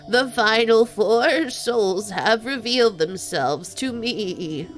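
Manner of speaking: loud, slow goat voice. Acted. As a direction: boom it out as the goat. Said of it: "I'm glad you like the goat voice".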